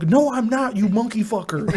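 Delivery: high, indignant prank-call insult; mock outrage. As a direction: fire back in hammed-up indignation. Acted argument, prankster insults the man.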